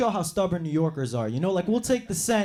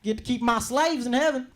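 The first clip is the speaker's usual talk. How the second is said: slave owner voice; 6 semitones higher than usual. Retold or imitated. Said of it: "They'd probably be like".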